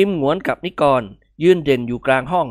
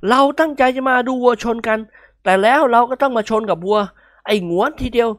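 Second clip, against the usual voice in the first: higher, sleepy complaint; mock-weary pitched-up moan; yawning grumble; 8 semitones higher than usual. A narrator's reading. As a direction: yawn then gripe. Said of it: "อ้าปากหาวดังๆ".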